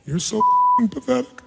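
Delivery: mock the whiny self-pity back at her; imitating her depressed tone. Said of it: "the tone of voice you say it when you stay depressed".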